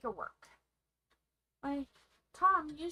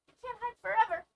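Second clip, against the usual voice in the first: loud taunt; 9 semitones higher, 8 dB louder, much faster than usual. Pretend. Repeated taunt, first take louder.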